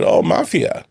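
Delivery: high, unvoiced mock awe; mock impressed. Dad teasing son about the mafia. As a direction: feign being impressed.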